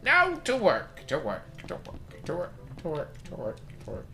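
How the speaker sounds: put-on high old doctor voice dying away to a whisper; cranky old man muttering off into silence; doctor fading away. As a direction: let each to work fade further until it's gone. Doctor trailing off to work.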